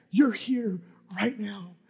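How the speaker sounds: usual speaking voice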